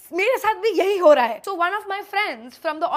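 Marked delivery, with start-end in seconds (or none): 0.1-1.4 louder, fast relieved blurt; eager agreement. Retold or imitated. Telling the rude guest she feels the same, louder. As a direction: jump in to agree.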